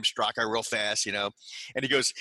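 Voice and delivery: ordinary talk, nothing marked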